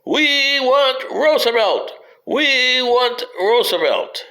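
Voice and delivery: high, slow chant of a convention delegate; retold yell. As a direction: call it out slowly like a rallying crowd chant. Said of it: "he yells out".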